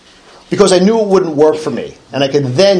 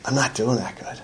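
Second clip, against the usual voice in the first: woman's answer, said much softer; quiet, low murmur; 4 semitones lower, 12 dB quieter than usual. Retold or imitated. Telling of the woman at the coffee pot, softly. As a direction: keep it low and hushed, near a murmur. Woman's reply, third meeting.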